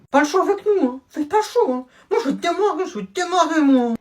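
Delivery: high, loud taunting voice; cocky kid threat. Acted. Little brother's friend threatens the big brother, high and loud.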